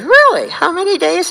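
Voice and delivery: high, loud sudden disbelief; the interviewer hears sixty-five days, amazed; surprised interviewer. Retold or imitated. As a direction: jump up in pitch, genuinely amazed.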